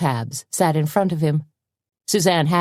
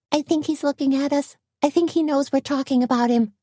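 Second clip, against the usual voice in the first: higher woman's voice; 11 semitones higher than usual. Acted.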